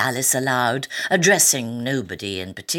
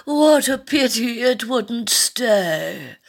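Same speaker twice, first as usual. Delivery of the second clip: higher, very slow regretful sigh; 10 semitones higher, 4 dB louder, much slower than usual. A narrator's reading. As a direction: sigh it sadly. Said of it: "sighed the lorry".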